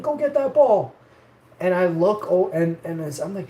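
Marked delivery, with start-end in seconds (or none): 0.0-0.9 retold coach yelling. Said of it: "he's screaming".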